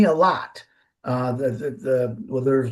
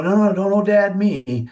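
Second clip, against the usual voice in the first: firm, a bit louder, no nonsense; stern rebuke; 4 semitones higher, 4 dB louder than usual. Retold or imitated. Grandpa scolding his daughter, stern and firm. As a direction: shut down the whining. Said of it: "in a stern voice".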